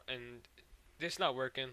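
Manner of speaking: usual speaking voice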